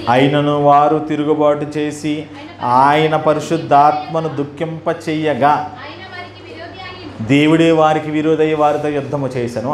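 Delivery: slow, measured verse reading; scripture reading. Acted. Preacher reads Isaiah's verse to congregation, slow scripture reading. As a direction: read the verse out slowly, word by word.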